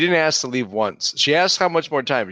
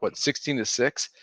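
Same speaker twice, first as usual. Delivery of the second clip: ordinary talk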